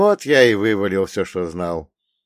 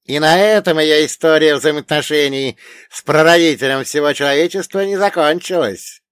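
high, loud elder hinting at more; intriguing storyteller, raised voice; dead old man teasing a secret; mysterious old man; 7 semitones higher, 7 dB louder than usual. A narrator's reading. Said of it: "загадочно произнёс мертвец".

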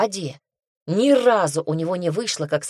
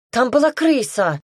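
high-pitched, agitated complaint; complaining; 7 semitones higher, 4 dB louder than usual. A narrator's reading. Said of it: "жалуется девушка". (жалобно, высоким голосом)

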